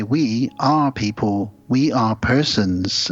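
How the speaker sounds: very slow, stiff, each word placed; slow stilted alien. Retold or imitated. Alien answers the couple's question, very slow and stiff. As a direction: recite it like a newly learned language. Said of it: "it's like they've just learned English".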